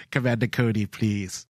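a lightly voiced evil emperor, low and soft; low mock Sidious voice. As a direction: purr it like a mock villain.

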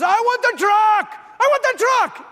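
high, loud mock tantrum; slow, high-pitched toddler shouting; imitated child yelling. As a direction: yell it like a demanding three-year-old. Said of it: "he's, like, yelling".

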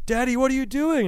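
put-on high, bright little-kid question; curious toddler. Son opens the garage door to ask dad, high and curious. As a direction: ask like a small boy peeking in.

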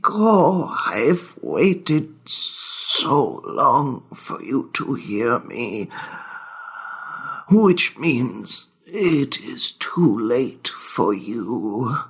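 mournful ghost weeping out its warning; crying ghost. Acted. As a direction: grieve the long wait, then turn ominous. Ghost wails to players.

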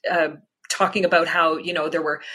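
normal talking voice

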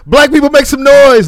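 loud high crowd call; hype shout. Retold or imitated.

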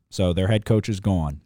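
ordinary talk, nothing marked

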